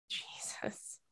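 barely audible whispered Jesus. Real, not acted. Feeling sick after reading it, a whispered Jesus. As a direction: let it out as a queasy whisper. Nauseous host reacting.

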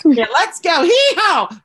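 very high, loud mock cowboy whoop; hammed-up Texan yelp; cowboy hee-haw. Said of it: "Texas and coming back saying".

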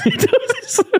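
high laughing agreement. Real, not acted. Cracking up at mate's joke, laughing high. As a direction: giggle it out up high.

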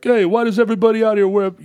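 put-on high, quick New York accent; New Yorker accent. New Yorker griping about locals' clothes, in a New York accent. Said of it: "the New Yorker's like".

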